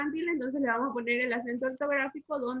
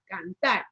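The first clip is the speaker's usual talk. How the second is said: "cantar" said lower, final TAR held; stress on last syllable; 6 semitones lower than usual. Shown as a demonstration. Teacher confirming where the accent falls, stress on TAR in "cantar". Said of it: "Lleva el acento en la última sílaba".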